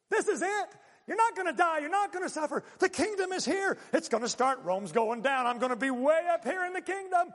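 high, loud eager dreaming of glory; gleeful, triumphant and loud; excited disciple. Retold or imitated. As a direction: voice of a thrilled disciple sure the kingdom has come.

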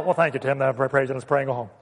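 low, underwhelmed mumbled reply; mock unimpressed congregant. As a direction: imitate a bored churchgoer, low and hurried. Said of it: "you look so excited and thrilled".

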